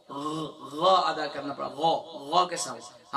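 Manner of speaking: "غ" voiced deep from the top of the throat, drawn out; throat sound demo. Shown as a demonstration. Teacher demonstrating the غ sound to his students. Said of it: "اسے ادا کرنے کے لیے".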